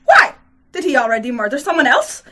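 loud, high burst of alarm; impetuous question. A narrator's reading. Hostess pressing the official about a murder, impetuous. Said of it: "asked impetuously".

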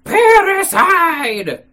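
high, loud, drawn-out cry. A narrator's reading. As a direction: cry out the accusation, slow and high. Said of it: "cried out".

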